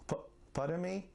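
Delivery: stuttering on the first word. Real, not acted. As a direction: catch on the f sound and restart. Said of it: "when I'm stuttering".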